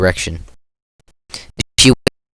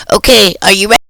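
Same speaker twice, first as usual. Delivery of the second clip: high, loud and eager; excited girl voice; 10 semitones higher, 6 dB louder than usual. Acted. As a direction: present it with a flourish. Natsuki presenting cupcakes.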